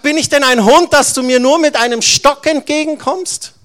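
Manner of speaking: furious giant shouting; roaring giant. Acted. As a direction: roar it down at the boy. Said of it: "brüllt der Goliath ihn an".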